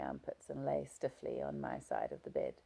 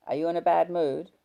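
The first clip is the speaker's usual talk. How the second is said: husband voice; 2 semitones higher, 9 dB louder than usual. Acted. Husband questioning wife.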